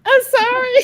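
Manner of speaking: high shouted apology through laughter; laughing apology. Real, not acted. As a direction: cry out sorry while laughing. Apology after being teased.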